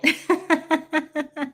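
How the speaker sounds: amused giggle; laughing. Real, not acted. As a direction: laugh at the private joke. Laughing at inside joke.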